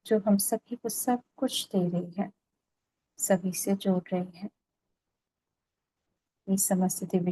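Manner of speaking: calm, even meditation voice with long pauses; guided meditation. Real, not acted. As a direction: lead them gently into stillness, phrase by phrase.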